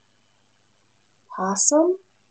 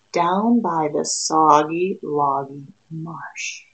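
usual speaking voice

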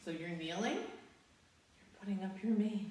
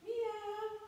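high cat meow; a playful high meow. Shown as a demonstration. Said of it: "arch your back up and go".

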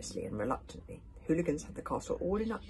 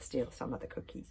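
ordinary delivery

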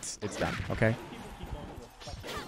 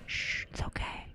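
soft hushed whisper, soothing a pet; ASMR whisper; 12 semitones higher, 3 dB quieter than usual. Pretend. Joking ASMR to the stream, soothing whisper. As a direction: whisper it like calming a scared buddy. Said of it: "Now for some [name] A S M R".

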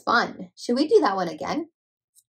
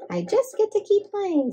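much higher, chirpy bunny answer; 9 semitones higher than usual. Acted. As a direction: pitch it way up like a happy bunny. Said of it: "says Bunny".

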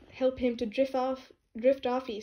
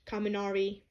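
ordinary delivery

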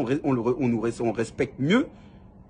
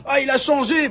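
higher, mocking imitation of the boss; mocking Noël; 13 semitones higher, 4 dB louder than usual. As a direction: parrot his words back much higher in scorn. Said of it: "T'as dit".

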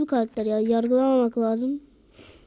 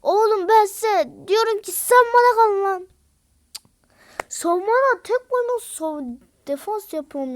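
high, loud exasperated yell; angry scolding; 8 semitones higher, 7 dB louder than usual. Real, not acted. Gamer yelling at teammates to stay back. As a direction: berate the team.

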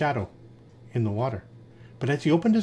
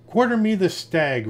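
a mild roar, a touch higher; 5 semitones higher, 4 dB louder than usual. A narrator's reading. Lion orders the other beasts, a mild roar. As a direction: command the beasts like a king, lightly roared. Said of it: "roared the lion".

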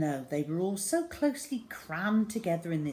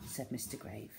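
ordinary delivery

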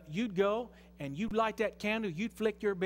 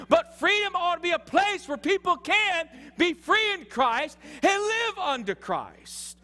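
insistent, raised pulpit voice; forceful preaching; 6 semitones higher, 4 dB louder than usual. Real, not acted. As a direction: press the point hard on the congregation.

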